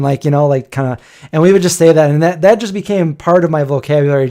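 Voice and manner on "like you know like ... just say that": ordinary talk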